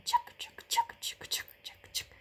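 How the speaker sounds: soft breathy chugging fading to nothing; whispered train chug, fading. A narrator's reading. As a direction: chug like a train leaving into the distance.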